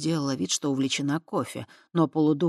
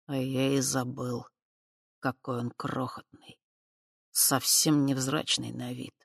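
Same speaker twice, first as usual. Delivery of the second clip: quiet musing; 3 semitones lower, 5 dB quieter than usual. Acted. Spirit musing quietly over key.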